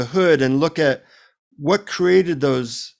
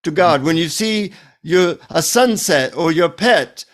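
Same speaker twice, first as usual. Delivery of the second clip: put-on insistent Indian-accented teaching, pitched up; Indian guru; 3 semitones higher than usual. Guru instructing the annoyed seeker, insistent Indian accent. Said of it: "And he says".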